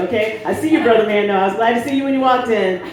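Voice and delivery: ordinary delivery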